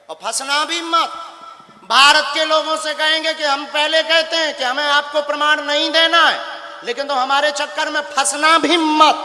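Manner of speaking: high, loud proclamation with a laugh; loud warning. Real, not acted. Guru warning the whole country not to trap him, loud and high. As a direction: warn everyone off, booming. Guru warns challengers to the crowd.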